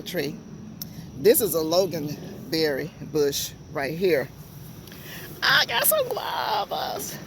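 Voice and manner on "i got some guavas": much higher, slow excited call; joyful shout. Real, not acted. Announcing to viewers she will get guavas, high joyful shout. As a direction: shout it out with delight. Guava tree finally flowering.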